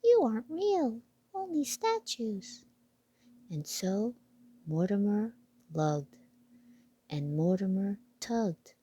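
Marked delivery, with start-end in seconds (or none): 0.0-2.6 high mouse voice; a tiny mouse working it out; piping mouse realisation; squeaky mouse voice. Acted. Mouse character speaks.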